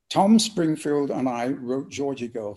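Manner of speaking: ordinary talk, nothing marked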